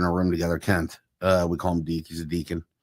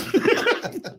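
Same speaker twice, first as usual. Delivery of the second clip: high laugh. Real, not acted. Laughing at elevator joke.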